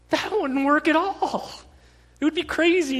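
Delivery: high, loud disbelief; incredulous. Real, not acted. Preacher scoffing at the customer's excuse, incredulous. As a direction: protest as if it's absurd.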